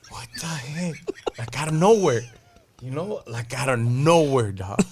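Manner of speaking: loud, high and incredulous; amazed disbelief. Real, not acted. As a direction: blurt it out in shock. Amazed reaction to the story.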